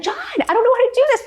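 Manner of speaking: high, whiny plea for help; squeaky helpless whine to her husband; helpless beginner. Retold or imitated. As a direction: play your clueless past self begging your husband. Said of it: "I'd be like".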